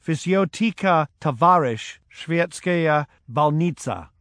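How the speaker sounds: doctor speaking mock Russian to the pilot; clear mock Russian. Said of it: "said with a clear voice".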